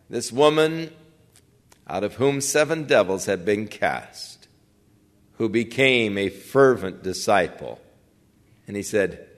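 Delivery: normal talking voice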